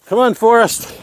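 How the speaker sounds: high-pitched encouraging pet voice; high cheering. Real, not acted. Owner cheering Forrest over the last bit, high encouraging call. Urging animal on the last stretch.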